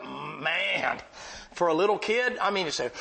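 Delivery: ordinary delivery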